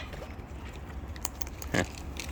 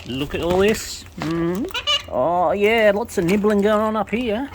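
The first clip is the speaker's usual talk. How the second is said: excited pet talk; 6 semitones higher than usual. Real, not acted. Birds nibbling, owner delighted.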